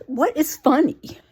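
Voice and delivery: indignant, drawn-out disbelief; exasperated. Acted. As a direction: spell out the annoyed question word by word. Annoyed reaction to giggling character.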